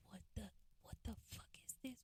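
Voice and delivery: hushed, quick whisper of disbelief; startled under-the-breath reaction; stunned whisper. Retold or imitated. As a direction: whisper it fast, as if peeking out in shock. Peeking out, stunned reaction acted.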